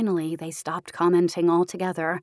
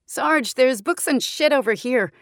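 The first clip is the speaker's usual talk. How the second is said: put-on higher, louder young soldier calling out; soldier calling to the sergeant; 7 semitones higher, 6 dB louder than usual. A narrator's reading. Soldier reports books to Sarge, called out loud. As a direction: shout the discovery to the sarge. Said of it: "another voice said".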